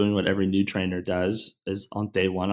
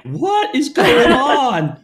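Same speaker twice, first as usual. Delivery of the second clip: much higher, slow and louder disbelief; bewildered class; 14 semitones higher, 7 dB louder, much slower than usual. Retold or imitated. Class reacting to the coroner story in disbelief. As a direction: stretch it out in shock.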